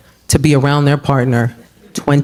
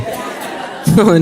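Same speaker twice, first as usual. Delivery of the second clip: laughing burst. Real, not acted. Laughs at own joke.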